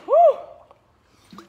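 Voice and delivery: high breathless whoop; loud woo. Real, not acted. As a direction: let out a big woo. After a hard round.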